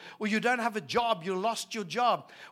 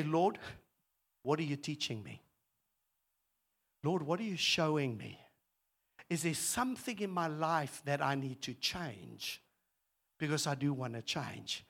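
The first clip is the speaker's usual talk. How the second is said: softer, quiet prayer to God; earnest prayer; 7 semitones lower, 7 dB quieter than usual. Retold or imitated. Preacher voicing his own prayer to God, softly. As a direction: pray it gently and sincerely. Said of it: "I say".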